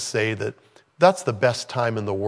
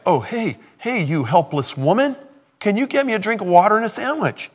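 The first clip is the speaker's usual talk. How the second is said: high, fast, cheeky demand; mock demanding stranger; 9 semitones higher than usual. Preacher mocking the prophet asking the widow for food, high and quick. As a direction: ham up a pushy traveler asking for food. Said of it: "It's like, like".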